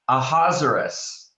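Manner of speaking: "Ahasuerus" rhyming with Lazarus; Ahasuerus as a-HAZ-er-us. Shown as a demonstration. Teacher introducing the king's name, Ahasuerus as a-HAZ-er-us. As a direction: say "Ahasuerus" in four syllables, a-HAZ-er-us. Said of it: "one of two ways we pronounce it".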